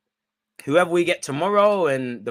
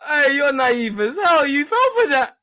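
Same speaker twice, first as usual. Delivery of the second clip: high, loud, drawn-out tease collapsing into laughter; laughing mockery; 11 semitones higher, 5 dB louder than usual. Real, not acted. Streamer teasing a naive commenter, helplessly amused. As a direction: wail it at them through real laughter.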